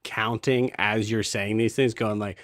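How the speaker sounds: usual speaking voice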